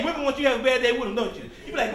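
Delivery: usual speaking voice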